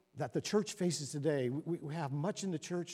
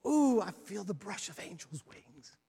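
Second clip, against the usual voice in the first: mock rapture; 5 semitones higher, 5 dB louder than usual. Mocking ecstatic worshipper.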